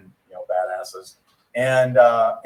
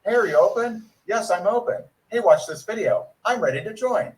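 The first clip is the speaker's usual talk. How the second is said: high, bright-eyed sales pitch voice; eager recruit voice; 10 semitones higher than usual. Retold or imitated. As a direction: play the overeager newbie, pitched up. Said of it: "Like you say".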